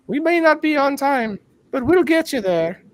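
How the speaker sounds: high-pitched prim British lady voice, lifted far up; British lady impression. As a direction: put on a sweet British stewardess accent. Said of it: "the British lady says".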